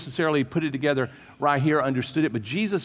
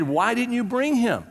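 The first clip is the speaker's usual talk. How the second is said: demanding; 8 semitones higher than usual. Retold or imitated.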